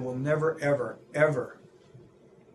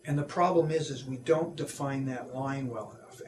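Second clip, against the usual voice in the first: ordinary talk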